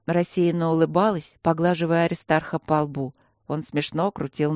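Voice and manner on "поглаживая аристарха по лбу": ordinary delivery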